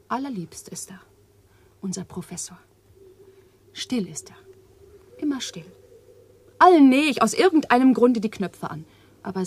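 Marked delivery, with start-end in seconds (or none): 0.1-5.6 soft, tender whisper. Acted. Eva confides her love for the professor, whispering. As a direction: breathe it like a secret.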